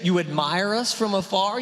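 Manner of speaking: ordinary delivery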